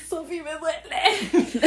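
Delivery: high, slow, whining mock cry of pain; comic pained wail, loud; imitating hurting partner. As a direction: squeal it like a man with a crushed hand. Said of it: "le estaba destruyendo la mano".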